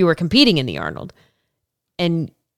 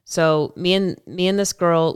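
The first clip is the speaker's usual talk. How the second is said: ordinary delivery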